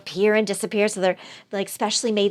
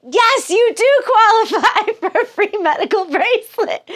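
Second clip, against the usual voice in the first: much higher, louder cheery promo voice cracking into laughter; prize recording voice; 10 semitones higher, 5 dB louder than usual. Retold or imitated. Prank line answering Greg, mock cheery and laughing. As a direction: play the phone robot but break up laughing. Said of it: "He's like".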